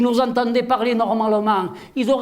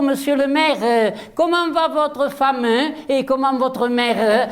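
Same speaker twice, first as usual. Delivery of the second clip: ordinary talk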